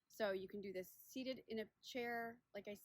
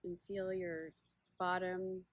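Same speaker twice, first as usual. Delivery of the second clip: unhurried meditation guide, long pauses between phrases; guided body scan; 2 semitones lower, 4 dB louder than usual. Acted. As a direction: guide listeners gently inward, leaving space after each question.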